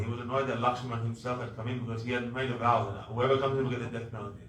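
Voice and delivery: usual speaking voice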